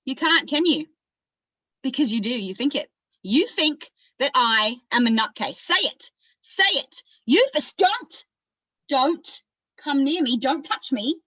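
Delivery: agitated Australian character, warding someone off; agitated accusation. Acted. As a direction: build from needling to high, insistent demands. Acted monologue, accusing then warding off.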